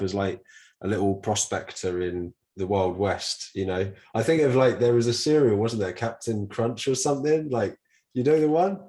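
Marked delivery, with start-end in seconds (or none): 4.1-8.8 high, eager recalling; bubbling over with the memory; excited. Real, not acted. Excited recalling cereal mascot.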